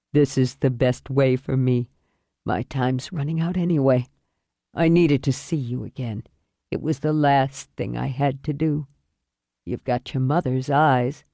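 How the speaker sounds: low, weary man's voice; dying father. Acted. Dying father speaks to daughter.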